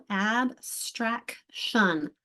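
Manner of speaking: Abstraction drawn out very slowly; teacher modelling Abstraction; slow model word. Shown as a demonstration. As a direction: stretch Abstraction for the class to hear. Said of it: "same thing with the T I in abstraction".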